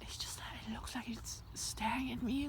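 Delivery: whispered worry. Real, not acted. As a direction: hush it, afraid. Scared, whispering about sheep.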